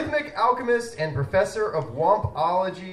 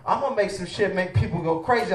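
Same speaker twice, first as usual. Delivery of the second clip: usual speaking voice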